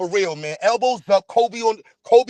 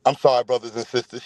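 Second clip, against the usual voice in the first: mock apology; 8 semitones lower than usual. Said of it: "Let me bring it back".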